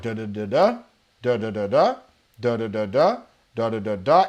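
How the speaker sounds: same little rising da pattern over and over; predictable speech rut. Retold or imitated. Coach mimics a client stuck in repetitive speech, rising da da rut. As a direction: drone in a stuck repetitive tune. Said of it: "they speak in ruts".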